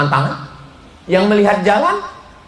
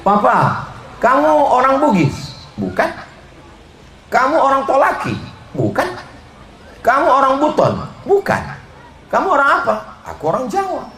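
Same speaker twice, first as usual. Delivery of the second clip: playful couple banter; 12 semitones higher than usual. Retold or imitated. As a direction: play both lovebirds in the quiz. Wife and husband quiz dialogue.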